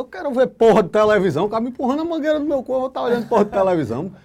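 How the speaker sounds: indignant rant. Retold or imitated. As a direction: vent it like you still can't believe it, fast. Revolta com o médico. (desabafo indignado, mais alto, agudo e rápido)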